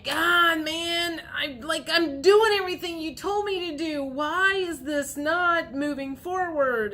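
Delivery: high-pitched exasperated whine; whiny tantrum. Acted. Viewer whining to the universe that nothing moves forward, tantrum-like. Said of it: "throwing a temper tantrum at the universe".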